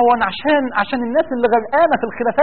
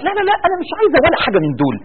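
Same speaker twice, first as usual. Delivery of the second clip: quick, higher protest as the wife; mock wife's refusal; 7 semitones higher, faster than usual. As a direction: rattle off the wife's refusal, fast and up.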